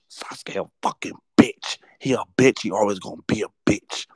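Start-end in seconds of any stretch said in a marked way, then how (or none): none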